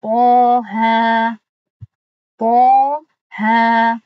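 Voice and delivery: loud, held Quranic letter names; loud tajweed recitation of the letters; recited letter names. Shown as a demonstration. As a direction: chant each letter, stretching the vowel two beats. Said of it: "پس اینو چگونه باید قرائت کنیم؟".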